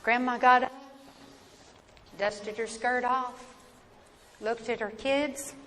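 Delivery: plain reading voice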